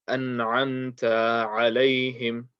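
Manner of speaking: slow "An'amta" with a long final "taa"; stretched ta. Shown as a demonstration. Teacher imitates a mistake someone might make, stretched ta. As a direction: drag out the ta of "An'amta" before "alayhim". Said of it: "is stretching this ta".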